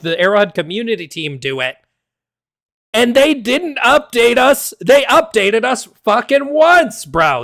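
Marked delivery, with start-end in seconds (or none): 2.9-7.1 angry rant. Real, not acted. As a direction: vent the frustration, pitched up. Ranting at the studio.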